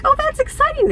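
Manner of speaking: high-pitched imitated delight; retold excitement. Mom retelling reaction to the Russian lady, high excited voice. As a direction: gush it high, as if reacting to news.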